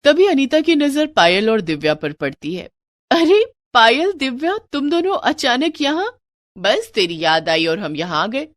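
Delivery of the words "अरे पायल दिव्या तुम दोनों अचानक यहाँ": put-on light up at the sight of old friends; happy surprise. Anita spots her college friends, delighted surprised cry.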